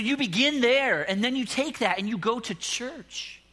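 fervent, slow exhortation; preaching. Retold or imitated. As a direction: drive it home like a preacher. Preaching advice to the man.

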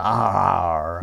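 low, rolled West Country R; a deep rural West Country R. Retold or imitated. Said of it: "she came out with an".

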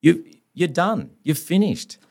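stern lawyer warning. Retold or imitated. Lawyer warning client of trouble.

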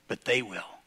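soft and low; hushed aside. Acted. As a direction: let it sink in quietly. Hushed echo.

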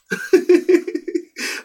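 very high, breathy laugh bursting out; high burst of laughter. Real, not acted. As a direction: laugh helplessly at the memory. Laughing at son's shout.

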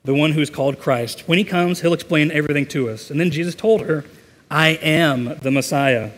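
lively, emphatic narration with clear phrase breaks; scripture story reading. A narrator's reading. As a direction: read the Bible story out to a congregation. Reads a scripture story aloud.

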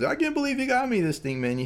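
very high, thrilled disbelief, imitated; high-pitched mock amazement. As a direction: imitate a grateful customer, voice shooting way up.

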